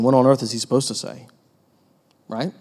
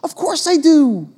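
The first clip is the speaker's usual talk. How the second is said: mock offended protest; 12 semitones higher than usual. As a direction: play the offended churchgoer. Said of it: "you'd be like".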